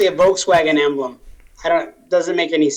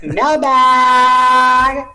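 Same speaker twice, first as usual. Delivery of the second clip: announcer call; 6 semitones higher, 6 dB louder than usual. Acted. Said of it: "I kinda like that".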